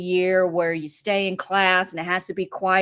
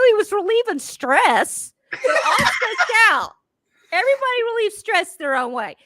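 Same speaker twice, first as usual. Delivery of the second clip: mock defense; 12 semitones higher, 3 dB louder than usual. Mom to the vice principal, mock-defending her son's fart with a laugh. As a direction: defend the kid with a grin, pitched up. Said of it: "I go".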